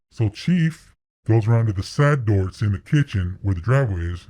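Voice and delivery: normal talking voice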